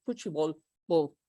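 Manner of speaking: "futebol, bol" ending in an "oo" glide; final L as U. Shown as a demonstration. Teacher explaining the ending, "bol" said like "bow" with a U. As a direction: let the L of "bol" melt into a U. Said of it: "It's like a U".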